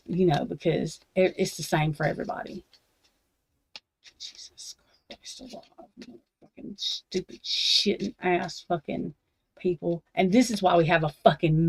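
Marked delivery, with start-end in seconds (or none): 4.2-7.4 slow whispered disbelief. Real, not acted. Reading more of the article, slow whispered disbelief. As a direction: breathe it out in exasperation.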